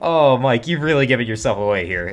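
loud, gleeful triumph; excited shouting. Real, not acted. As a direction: shout it out, thrilled he slipped up. Gleeful after catching the creep.

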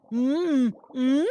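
closed-mouth questioning hum; mumbling. Acted. Birdie answers the shark with a mouthful, wordless mumble. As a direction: mumble through a full mouth. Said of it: "mumbled Birdie".

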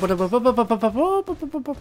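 high rapid what-what-what, rising; panicked babble. Real, not acted. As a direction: gabble in panic as you get hit. Panicked repeating in fight.

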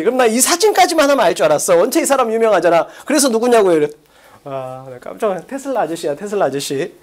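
ordinary delivery

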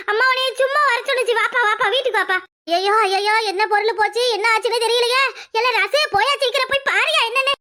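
loud, high frantic cries; panicked calling. Acted. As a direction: shout the alarm, rising in panic. Calling out in panic about house.

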